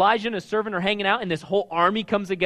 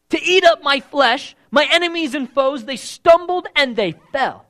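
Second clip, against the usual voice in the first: louder, higher, bold and cocky; with attitude; 8 semitones higher, 8 dB louder, slower than usual. Retold or imitated. Preacher voicing King David's defiant psalm with attitude. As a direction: read it like a dare. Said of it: "You gotta read King David with attitude".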